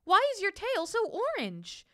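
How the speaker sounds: high, astonished little bird question; high chirpy voice full of astonishment. A narrator's reading. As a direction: ask in wide-eyed bird amazement.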